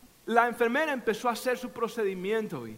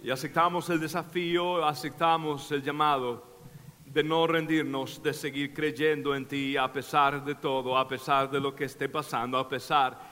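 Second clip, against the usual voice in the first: much softer and lower prayer voice; quiet prayer; 8 semitones lower, 4 dB quieter than usual. Acted. Pastor leading the congregation in prayer, soft and low. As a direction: lead them gently into God's presence.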